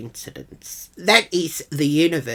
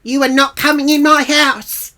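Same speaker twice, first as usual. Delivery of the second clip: high, loud outraged refusal; acted-out furious outburst; angry shout; 10 semitones higher, 9 dB louder than usual. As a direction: yell it like barring the door. Acted refusal at peak of quarrel.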